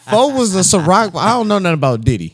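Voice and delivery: loud and high, hyping; big up your friend; hyped up. Real, not acted.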